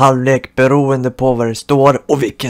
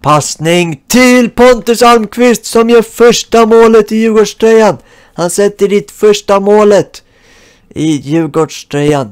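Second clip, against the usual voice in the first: mock sports commentator; 9 semitones higher, 7 dB louder than usual. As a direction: call the goal like a TV commentator. Calls goal as mock TV commentator.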